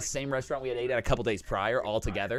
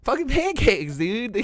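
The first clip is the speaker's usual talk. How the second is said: much higher, laughing disbelief; 10 semitones higher, 4 dB louder, slower than usual. Retold or imitated. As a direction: laugh at the absurd wait in shock. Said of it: "We were like".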